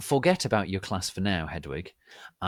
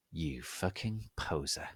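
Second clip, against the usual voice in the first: much softer, low under the breath; muttered; 3 semitones lower, 9 dB quieter than usual. A narrator's reading. Narrator muttering an insult at Dumbledore, soft under the breath. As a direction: mutter it so Dumbledore can't hear. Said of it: "I muttoned".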